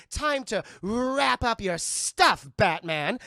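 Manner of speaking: loud, slow, drawn-out punning; hammed-up comic-book villain; villain's taunt. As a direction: relish each word, loudly. Villain's pun taunt at Batman.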